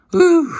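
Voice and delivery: high, loud exclaimed whew. Real, not acted. Watching a hard hit on film, loud whew. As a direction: let out a big impressed whew.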